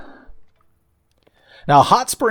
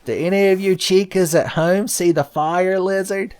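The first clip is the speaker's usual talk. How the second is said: put-on higher-pitched kids' show host quizzing viewers; Dora the Explorer; 8 semitones higher than usual. Gamer asking kids at home to spot the fire lizard, mock Dora the Explorer. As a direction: call out to the children at home, pitched up. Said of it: "It's like Dora the Explorer".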